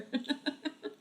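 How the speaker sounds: high, soft giggle; amused laugh. Real, not acted. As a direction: crack up at your own attempt. Cracks up at own accent.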